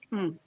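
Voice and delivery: a pleased mmm while chewing; savoring hum. Real, not acted. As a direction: hum with delight at the taste. Another bite.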